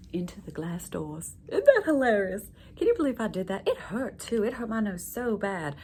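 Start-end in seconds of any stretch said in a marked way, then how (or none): 1.5-5.8 much louder burst of amusement; sudden loud giggly exclamation; loud amused outburst. Acted. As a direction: blurt it out, tickled by the memory. Laughs about her own mishap.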